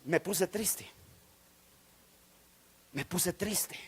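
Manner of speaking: low, soft, deflated retelling; subdued, crestfallen admission; downcast. Retold or imitated. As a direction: let the voice sink in disappointment. Recalls disappointment after the prophecy.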